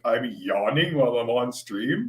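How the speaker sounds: high mocking quote. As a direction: parrot the troll's line back in scorn. Mocking troll's words.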